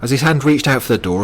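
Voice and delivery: ordinary delivery, nothing marked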